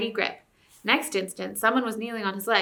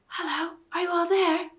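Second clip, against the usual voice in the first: put-on high, light girl's voice, hushed; a young girl whispering in the dark; 9 semitones higher than usual. A narrator's reading. Jill finds them in the dark, high girlish whisper. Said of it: "a voice whispered".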